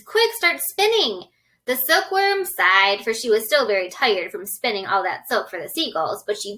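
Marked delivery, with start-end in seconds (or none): 0.0-1.3 high, slow urgent command; urgent order. Acted. James ordering the silkworm, urgent and high. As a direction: hurry her along.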